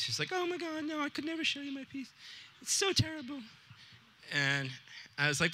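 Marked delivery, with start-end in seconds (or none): 0.3-3.4 put-on much higher, small girlish voice for a shy student; high-pitched shy student refusing to show her work. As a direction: play the embarrassed girl, voice pitched way up. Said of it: "she's very kinda shy and reticent".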